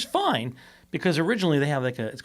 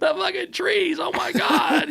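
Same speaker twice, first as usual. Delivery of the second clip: very high, mock shouting; excited driver impression; slower than usual. Driver who watches the podcast teasing host, mock excited shout. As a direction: shriek it like the worked-up driver, in jest.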